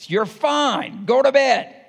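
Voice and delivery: loud, high parental yell; retold holler. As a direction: bark it at the crying kid. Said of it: "me hollering at Cody".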